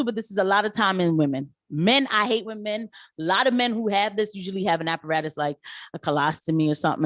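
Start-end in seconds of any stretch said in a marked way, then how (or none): none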